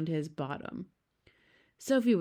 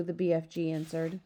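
plain reading voice

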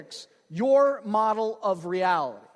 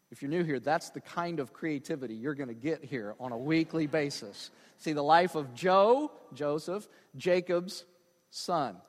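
ordinary talk

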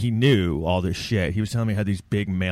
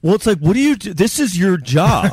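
mock exasperated; 10 semitones higher, 4 dB louder than usual. Real, not acted. Mock exasperation at bad athletes.

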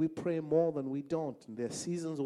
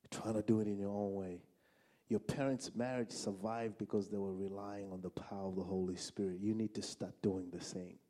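low, subdued, measured divine voice; voice of God; 7 semitones lower, 5 dB quieter than usual. Retold or imitated. God counselling a struggling husband, low gentle voice. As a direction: speak low and soft, gently correcting. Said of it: "I heard the voice of God saying".

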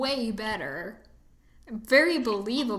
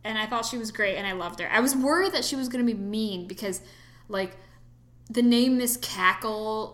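ordinary delivery

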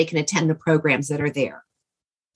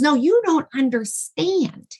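earnest protest; 10 semitones higher than usual. Retold or imitated. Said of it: "He goes".

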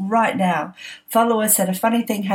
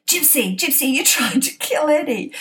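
mock accusation; 6 semitones higher than usual. Mock accusing daughter on phone.

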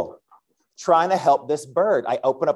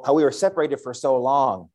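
ordinary talk, nothing marked

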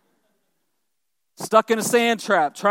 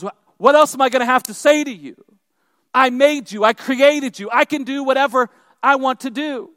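acted exasperated God, raised and forceful; angry, frustrated; 4 semitones higher, 5 dB louder than usual. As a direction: scold like a fed-up parent, voice up. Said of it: "He begins to get angry with him".

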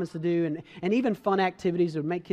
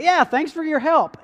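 dismissive dad; 8 semitones higher, 7 dB louder than usual. Retold or imitated. Dad brushing off son's help.

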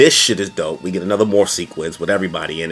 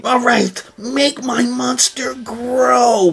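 much higher, very slow mock sorceress; villain Rita voice; much slower than usual. Rita calling for her monster to grow, in a high dragged villain voice. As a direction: drag it out like a hammy evil queen. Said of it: "in the middle of her saying".

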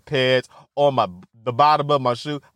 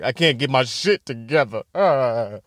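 ordinary delivery

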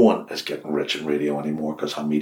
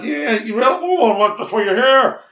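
flustered reply; 5 dB louder than usual. Retold or imitated. Host voices Brian's flustered reply.

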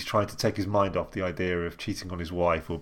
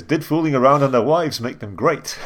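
news anchor voice, higher, louder, breaking into laughs; laughing anchor voice; 6 semitones higher, 5 dB louder than usual. Retold or imitated. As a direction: announce it like a TV anchor, cracking up. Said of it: "he says".

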